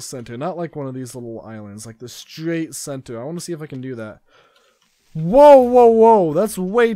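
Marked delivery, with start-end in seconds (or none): 5.1-6.9 high loud alarmed whoa; startled whoa. Real, not acted. Gamer startled by an early attack, shouting whoa. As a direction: jump back in alarm. Attacked right after spawning.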